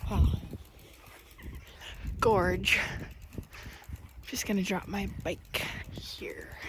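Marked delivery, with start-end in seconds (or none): 4.3-6.6 whispering. Real, not acted. Quietly setting bike down.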